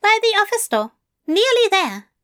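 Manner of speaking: high, bewildered fussy voice; baffled angel voice. A narrator's reading. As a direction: ask in baffled disbelief.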